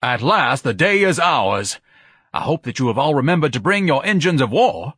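high, pompous orator; ringing, self-important speech; grand. A narrator's reading. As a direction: address the troops grandly. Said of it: "Ignatius said grandly".

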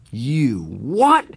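high incredulous angry shout. Acted. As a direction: bark it in disbelief. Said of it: "He was shouting".

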